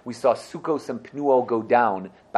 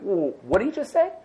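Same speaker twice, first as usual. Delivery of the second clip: suspicious surprise; 8 semitones higher than usual. Retold or imitated. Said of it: "were like".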